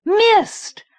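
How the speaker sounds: high, loud joyful cry; thrilled cry. Acted. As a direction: cry out her name in delight. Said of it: "so surprised and so thrilled that she nearly shouted".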